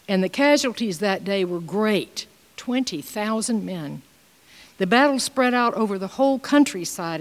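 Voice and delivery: solemn Bible reading voice; scripture reading. Acted. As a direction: read the passage out to the congregation. Reads scripture aloud.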